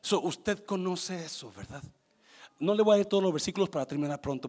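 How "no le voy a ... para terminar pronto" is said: rushing. Real, not acted. Rushing, says wants to leave.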